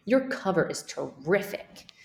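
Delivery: usual speaking voice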